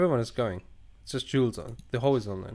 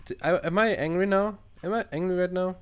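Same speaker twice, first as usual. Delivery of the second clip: pitched up, baffled; high indignant question; indignant disbelief; 7 semitones higher than usual. Real, not acted. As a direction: protest that you're not angry. Reacting to game's angry label.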